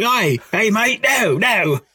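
put-on high, playful English accent; a drunk Englishman shooing birds off in an English accent. Drunk man yelling at the emus, mock English accent.

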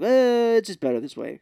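high, loud "well" sliding down to normal; hammed-up nurse excuse. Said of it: "And I was like".

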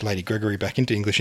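usual speaking voice

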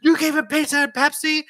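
high, loud incredulous question; appalled disbelief. Retold or imitated. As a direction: imitate a horrified lady scolding. Lady's appalled question in story.

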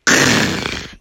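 rough, unpitched crunch sound; crushing noise. Acted. Imitates ogre crushing truck.

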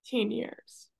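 holding back tears while she speaks; getting emotional. Real, not acted. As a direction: voice high and tight with real emotion, near tears. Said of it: "oh, I'm getting emotional".

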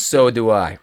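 put-on makeshift Russian-ish accent; bad Russian accent. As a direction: imitate the huge stranger's vague Russian accent. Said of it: "this is the accent we're getting".

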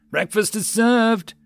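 high, loud, drawn-out gleeful call; gleeful yell. A narrator's reading. Door gunner hosing down the crowd, gleeful yell. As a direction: holler it with relish. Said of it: "gleefully yelled".

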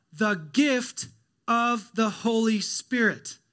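every word drawn out, slow and weighty; slow emphasis. Real, not acted. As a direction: land the answer slowly. Answers his own question.

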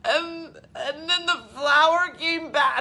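high, worked-up hysterical retelling; hammed-up emotional meltdown; mock hysterical. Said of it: "and I'm, like, hysterical".

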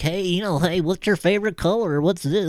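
lightly mock an awkward nerd hitting on her; nerdy guy. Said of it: "nerds like".

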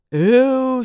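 loud, high drawn-out aww; children whining. A narrator's reading. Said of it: "sagde børnene".

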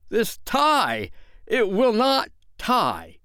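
high, exasperated yell; frustrated father losing his temper; really yelling. A narrator's reading. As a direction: yell it slowly, pitch shot way up. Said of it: "He really yelled".

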